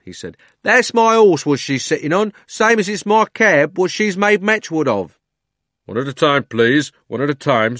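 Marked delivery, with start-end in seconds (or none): 0.6-5.2 high, loud cockney protest; angry cabby. A narrator's reading. 5.9-7.8 london policeman. A narrator's reading. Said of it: "said the policeman".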